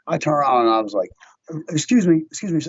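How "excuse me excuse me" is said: raised, higher call after him. Retold or imitated. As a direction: call him back sharply. Calling back to the man.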